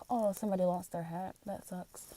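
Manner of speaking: distracted remark, barely voiced; quiet aside. Real, not acted. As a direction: drop the voice right down, as if to yourself. Aside about a lost hat.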